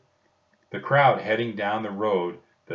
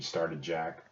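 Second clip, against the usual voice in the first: ordinary delivery, nothing marked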